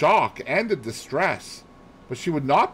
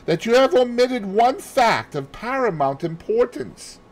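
higher, patronising praise; Poirot voice; 10 semitones higher than usual. Acted. Poirot gently chides Hastings for his disorder, higher.

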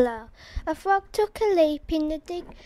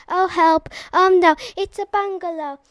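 frightened cry; 2 semitones higher, 4 dB louder than usual. Acted. The frog meets the bungalow, a loud, hammed-up fright. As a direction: cry out in mock terror.

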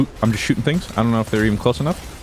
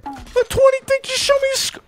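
very high squeak; squeaky disbelief at the score; squeaky exclamation. Real, not acted. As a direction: pitch way up in disbelief. Reacts to the score screen.

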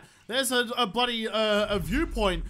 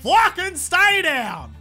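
high, loud combat rant; loud gamer trash talk, pitched up; exasperated battle yelling; 6 semitones higher, 8 dB louder, slower than usual. Real, not acted. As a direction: bellow at enemies who won't stay dead. Yelling at revived enemies in fight.

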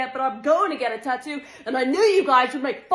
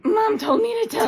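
put-on kid voice; 8 semitones higher than usual. Kid relaying between mom and grandma.

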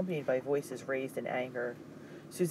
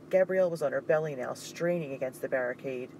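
plain reading voice

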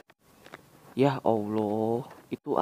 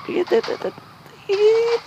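excited squeal; 5 dB louder, slower than usual. Real, not acted. Dragon car flying.

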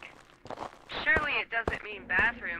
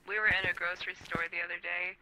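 ordinary delivery

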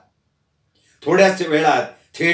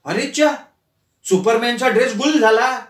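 mock surprise; 6 semitones higher than usual. Wife mocks the missing suit.

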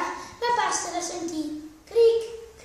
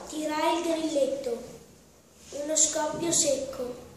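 plain reading voice

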